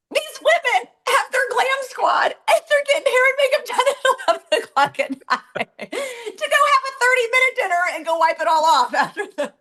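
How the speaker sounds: high-pitched incredulous rant breaking into laughs; laughing disbelief. Real, not acted. Woman mocks glam squad before a short dinner, high laughing disbelief. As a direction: squeal the complaint through laughter.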